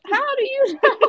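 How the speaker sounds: very high, laughing question; retold giddy disbelief; incredulous squeal. Teasing retold exchange with mother.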